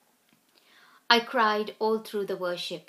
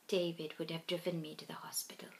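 whispering; 2 semitones lower, 8 dB quieter, faster than usual. A narrator's reading. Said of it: "I broke into sobs".

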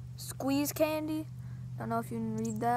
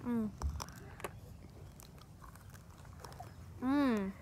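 mumbling while eating; chewing candy; 2 semitones lower, 12 dB quieter than usual. Real, not acted. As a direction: mouth full of candy. Seen 3: Woman on left brings candy to mouth and visibly chews throughout.

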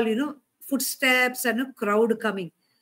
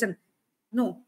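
ordinary talk, nothing marked

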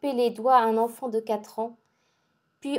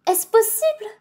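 much higher, louder amazed question; astonished cry; 10 semitones higher, 4 dB louder than usual. A narrator's reading. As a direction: cry out in disbelief. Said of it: "cria Lise étonnée".

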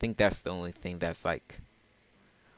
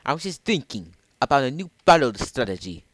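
put-on higher, louder British accent; 6 semitones higher, 10 dB louder than usual. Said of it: "British sort of accent".

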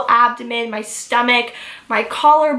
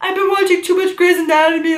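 high, fast, frightened blurt; panicked quote; 6 semitones higher, faster than usual. Retold or imitated. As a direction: replay the scared line you told the EMT. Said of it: "I literally said".

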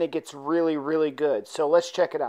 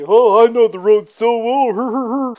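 slow, loud, sing-song mockery of a know-it-all; hammed-up sneering mimicry; mocking imitation; 5 semitones higher, 10 dB louder than usual. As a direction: parrot his boast back at him, louder and slower. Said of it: "[name] mocks Frankie".

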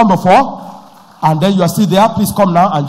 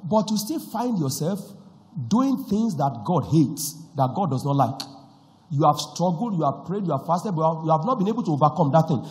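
hushed altar call; 6 dB quieter than usual. Acted.

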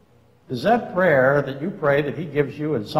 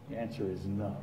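much softer, lower repeat; quiet echo; 7 semitones lower, 17 dB quieter than usual. Real, not acted. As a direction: let it fall to a hush. Quiet repeat of his answer.

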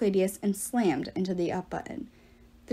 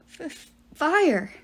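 higher, slow, frightened stammer; stammering small voice; 6 semitones higher, slower than usual. Acted. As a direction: trip over the first sound in fear. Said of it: "she stammered in a small voice".

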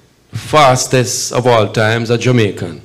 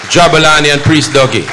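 loud, raised announcement over applause; announcing names; 5 semitones higher, 9 dB louder than usual. Real, not acted. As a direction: call the names out to the crowd.

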